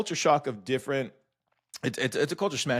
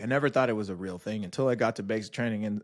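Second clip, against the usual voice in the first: usual speaking voice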